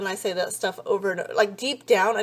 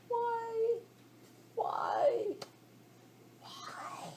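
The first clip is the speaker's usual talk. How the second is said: hushed why; 13 semitones higher, 13 dB quieter, much slower than usual. Real, not acted. Scolding dog while recording.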